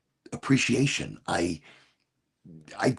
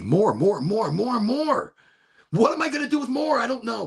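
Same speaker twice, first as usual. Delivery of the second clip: rising higher with each more; greedy escalating chant of more; building want; 9 semitones higher than usual. Real, not acted. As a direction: climb higher on every more, then burst into the question.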